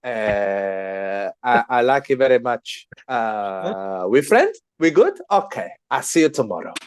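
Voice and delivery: hammed-up Turkish kebab seller accent; mock Turkish accent. Playing a Turkish kebab seller to friends, mock Turkish accent. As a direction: greet a customer in a put-on Turkish accent. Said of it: "attempt a Turkish accent".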